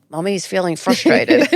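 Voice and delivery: exasperated mum, laughing it out; laughing frustrated version. Shown as a demonstration. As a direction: blurt it out with a laugh, half exasperated. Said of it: "It's usually".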